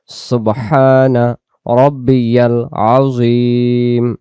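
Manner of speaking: সুবহানা রব্বিয়াল আযীম recited very slowly, each syllable full; slow recited Arabic. Shown as a demonstration. Teacher showing the bowing phrase, slow recitation. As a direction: chant it slowly like in prayer. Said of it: "তাহলে রুকুতে বলবো".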